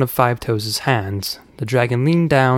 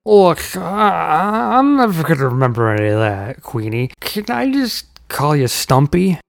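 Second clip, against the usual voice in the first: cheeky; 4 semitones higher than usual. A narrator's reading. Cheeky uncle interrupts.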